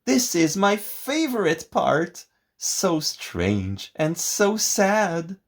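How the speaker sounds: high, slow swooning lady; dreamy lady voice. A narrator's reading. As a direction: sigh it like an opera lover. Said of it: "said the lady".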